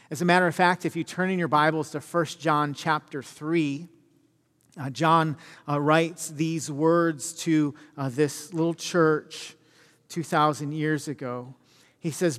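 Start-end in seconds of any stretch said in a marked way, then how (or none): none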